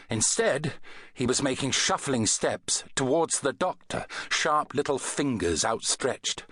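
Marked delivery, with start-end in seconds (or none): none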